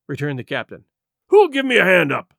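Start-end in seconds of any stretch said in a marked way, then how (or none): 1.3-2.3 loud, higher angry roar. Acted. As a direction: roar it at the whole crew. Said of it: "he roared".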